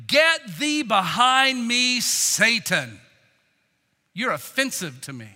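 slow, loud command dropping at the end; stern rebuke. Acted. Jesus putting Peter in his place, stern. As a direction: drive him back with drawn-out words.

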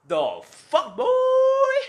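put-on "boy" shoots up high and loud in disbelief; a startled, incredulous yelp; baffled outburst. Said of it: "And then I'm just like".

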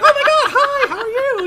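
very high, loud, gushing phony greeting; Dave Chappelle. Retold or imitated. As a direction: overdo the fake excitement, half laughing. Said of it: "I would never talk that way".

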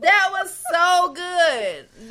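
slow, drawn out through laughter; so good it hurts, laughing; laughing praise. Real, not acted. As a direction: savor the joke while laughing.

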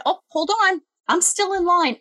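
high, sweetly firm correction; fake-polite firmness. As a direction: politely put her back in her place. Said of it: "I said".

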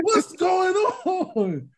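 high, very slow, tearful plea; choked up. Retold or imitated. Recalling a sad episode to friends, slow tearful plea. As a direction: drag it out through mock tears. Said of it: "I was getting choked up".

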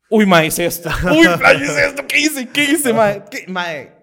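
high, loud frantic panic; spiraling in drunken panic; frantic inner panic; panicked self-talk. Retold or imitated. Panic after drunken call.

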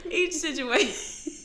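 put-on high, laughing boyish squeak; squeaky pubescent boy through laughter; teenage boy voice. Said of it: "she sound like a little teenage boy".

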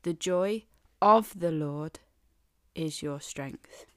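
slow, with a pause after Lord; real emphasis. Shown as a demonstration. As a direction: land the Lord then drop to is your strength. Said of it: "But the real emphasis It's this".

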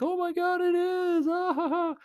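high, laughing mimic of teasing kids; mocking laughter. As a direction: squeal it out like giggling kids mocking you. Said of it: "they just started laughing like".

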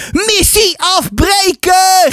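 put-on high, drawn-out boy's yell. A narrator's reading. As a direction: shriek the order to retreat. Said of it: "gilde Gozert".

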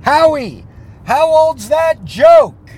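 loud, high shout across the room; yelled heckle. Retold or imitated. Heckler shouting at comedian Howie from his seat, loud yell. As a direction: heckle the comedian at full volume. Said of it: "I yelled out".

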